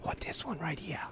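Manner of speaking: mock Walken whispering as he picks a chair. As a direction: hush it right down. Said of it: "and was like".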